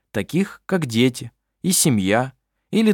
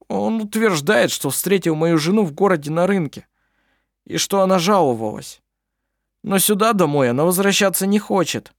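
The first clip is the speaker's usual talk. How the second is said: high, worried man; a distressed brother explaining; upset simple man; 8 semitones higher than usual. A narrator's reading.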